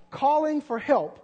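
ordinary talk

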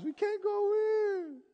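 high, drawn-out imitation wail; hammed-up crying, stretched slow; mock weeping. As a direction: wail it like a tearful crowd. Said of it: "Tears was coming down their eyes".

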